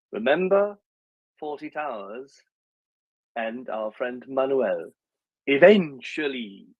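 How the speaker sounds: a light put-on Spanish waiter accent as Manuel from Fawlty Towers, slow; hammed-up Manuel accent, slow; mock Manuel accent. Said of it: "best Jamaican accent I've ever heard".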